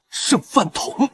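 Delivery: defiant shout. Acted. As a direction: roar it back at him. Defiant fight against enemy. (怒吼)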